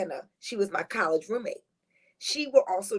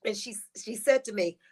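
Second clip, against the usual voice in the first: usual speaking voice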